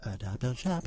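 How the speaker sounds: quiet echo. Real, not acted. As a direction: murmur "Adopt, don't shop" back like a chant. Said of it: "echoing the slogan".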